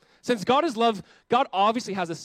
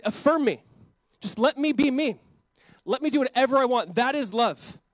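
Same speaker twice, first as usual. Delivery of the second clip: higher, louder whiny demand; mock entitled demand; 7 semitones higher, 5 dB louder than usual. Mocking the social media mantra, loud and whiny. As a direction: sneer the slogan like a spoiled kid.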